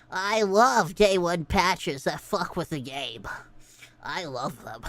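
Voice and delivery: very low, slow mock-gamer voice; put-on fan voice. Streamer quoting patch-loving gamers, low mocking voice. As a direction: drop deep and slow, quoting imagined fans.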